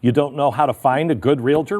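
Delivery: ordinary delivery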